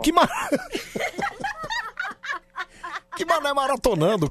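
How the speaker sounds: high squeal of laughter; cracking up mid-question; laughing. Real, not acted. Laughs at excuse.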